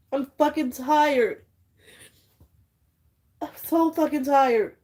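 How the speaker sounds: high, slow, drawn-out cry of being fed up; real, close to breaking down; tearful exhaustion. Real, not acted. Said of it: "I'm trying not to cry 'cause I hate crying on camera".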